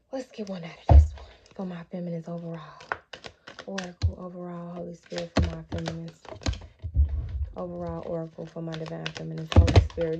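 low, hushed prayer to the Holy Spirit; murmured prayerful request. Acted.

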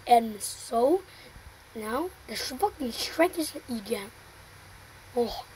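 ordinary talk

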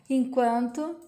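ordinary delivery, nothing marked

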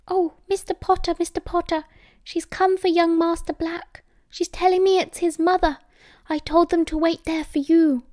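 high-pitched, flustered servant voice; high voice of an agitated elf; anxious house elf. A narrator's reading. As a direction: squeak it out as a worried little elf. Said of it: "wringing his hands anxiously, clearly very confused".

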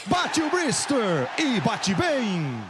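ordinary talk

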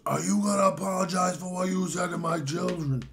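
high, indignant put-on voice; mock angry demand. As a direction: imitate an irate drunk confronting someone. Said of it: "just getting angrier".

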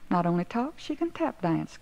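usual speaking voice

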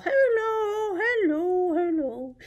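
lightly voiced high turtle greeting; a hint of a shy turtle saying hi; turtle puppet. Turtle puppet greeting the children, high and light.

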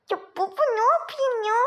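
usual speaking voice

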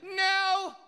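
high, loud mock "No!"; a squeaky pretend protest. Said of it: "You guys think you argue, like".

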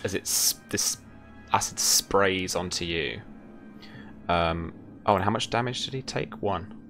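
ordinary talk

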